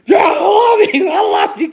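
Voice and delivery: high, loud, half-crying "I love you"; laughing shout. Acted. Man shouts as his wife crushes him playfully. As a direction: yell it like you're being squeezed to death. Acting out wife's rough play.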